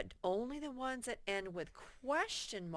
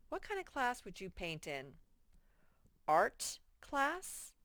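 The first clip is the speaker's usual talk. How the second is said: normal talking voice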